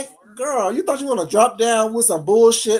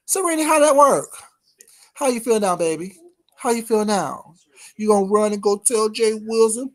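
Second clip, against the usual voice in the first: normal talking voice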